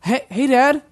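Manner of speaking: playing the kid. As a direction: call to Dad like a kid, tentatively. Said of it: "I'm playing the Texas kid".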